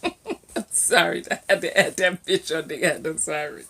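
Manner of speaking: amused at oneself, words breaking into laughter; laughing apology. Real, not acted. Vlogger apologises for adding a swear word, laughing. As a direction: apologise through a laugh, low and quieting down.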